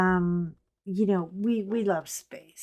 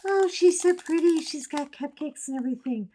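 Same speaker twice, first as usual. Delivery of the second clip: much higher, gushing mock newbie voice; naive newcomer; 8 semitones higher, faster than usual. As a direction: coo like a starry-eyed beginner. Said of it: "If a newcomer ever thought".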